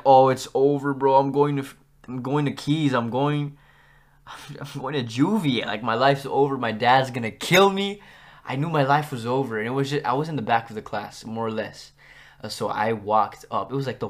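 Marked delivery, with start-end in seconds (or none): 0.0-8.0 louder, hammed-up dread; mock panic. Student in trouble imagining dad's reaction, mock panic. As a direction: spiral into comic doom. Retold inner panic at being caught.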